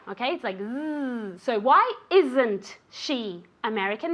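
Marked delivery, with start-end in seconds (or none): 0.6-1.4 "Z" as a long buzzing "zzz", like a bee; buzzing Z sound. Shown as a demonstration. Teacher showing the sound in is, "Z" buzzed like a bee. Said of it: "Remember that it's like a B". 1.6-4.1 slow, with "isn't" buzzed as "izzn't". Shown as a demonstration. Teacher modelling the question slowly, "isn't" with a buzzing z. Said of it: "Remember that it's like a B".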